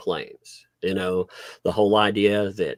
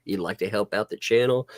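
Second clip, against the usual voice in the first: ordinary talk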